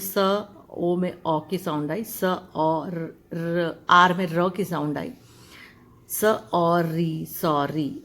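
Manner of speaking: सॉरी broken into स, ओ, री, then joined slowly; sound by sound. Shown as a demonstration. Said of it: "एस में स की sound आती है". (सॉरी को ध्वनियों में तोड़कर)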